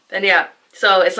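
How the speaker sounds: ordinary talk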